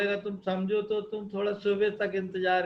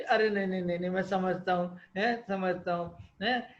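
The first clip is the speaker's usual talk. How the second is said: ordinary talk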